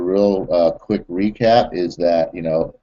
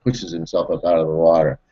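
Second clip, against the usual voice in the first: ordinary talk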